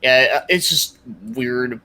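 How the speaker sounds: normal talking voice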